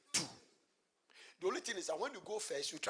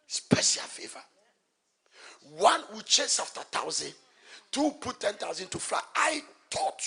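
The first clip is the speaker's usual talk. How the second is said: loud, rising preacher's cadence; full-force preaching; 5 semitones higher, 18 dB louder than usual. Acted. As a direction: drive the point home to the congregation.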